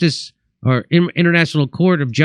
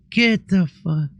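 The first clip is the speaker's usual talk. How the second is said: stunned disbelief; 5 semitones higher, slower than usual. Retold or imitated. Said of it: "And I'm like".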